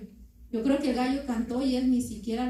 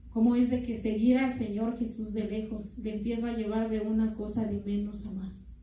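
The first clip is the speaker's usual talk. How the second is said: normal talking voice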